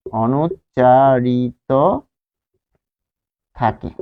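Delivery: drawn out very slowly; slow spelled-out word. Shown as a demonstration. Teacher stretching the word for students, very slow. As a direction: stretch every syllable for the class.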